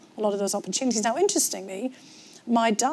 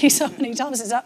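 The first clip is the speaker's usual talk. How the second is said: ordinary delivery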